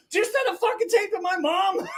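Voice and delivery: very high, mock-furious outburst; hammed-up outraged accusation; mad friend. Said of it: "he was mad".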